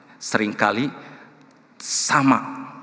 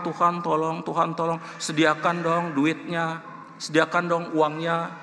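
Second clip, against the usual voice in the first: pleading prayer; 7 semitones higher than usual. Retold or imitated. Preacher re-enacting his prayer for funds, pleading. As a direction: imitate yourself begging God for money. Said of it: "saya berdoa, berdoa".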